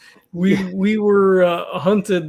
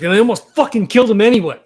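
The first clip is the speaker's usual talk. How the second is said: angry outburst; 3 semitones higher, 7 dB louder, much faster than usual. Acted. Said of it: "Nash was like".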